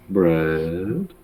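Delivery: lightly voiced slow dim-witted guy; a hint of a dopey goldfish drawl; Bubba Goldfish voice. Shown as a demonstration. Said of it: "the Bubba Goldfish voice".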